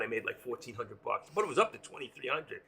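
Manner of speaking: usual speaking voice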